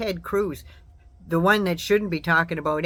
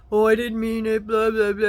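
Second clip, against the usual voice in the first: mock bawling; 3 semitones higher, 4 dB louder than usual. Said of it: "snotting and bawling and crying and saying".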